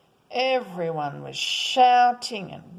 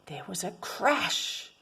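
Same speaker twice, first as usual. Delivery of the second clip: plain reading voice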